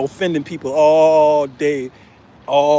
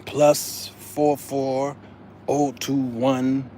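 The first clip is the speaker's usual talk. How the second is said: very slow, softer, digit by digit; slow number reading; 3 semitones lower, 6 dB quieter, much slower than usual. Retold or imitated. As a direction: read out the endless number. Reading long phone number.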